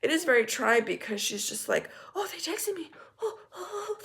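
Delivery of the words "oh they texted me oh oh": much higher, softer, whimpering put-on voice; mock her as a helpless whiner; mocking the selfish coworker's excuse in a whimpering voice; mock whiny coworker.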